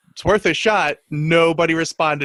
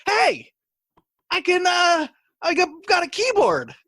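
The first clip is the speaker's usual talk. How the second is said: very high, eager boast; excited boast; 10 semitones higher than usual. Retold or imitated. Roommate showing off his new keyboard, high and excited. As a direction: show off the new keyboard like a proud kid. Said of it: "And you're like".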